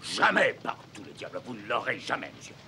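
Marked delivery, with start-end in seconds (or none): 0.0-0.5 loud, high refusal; angry shout. Acted. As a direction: bark out a furious no. Captain refuses the sale.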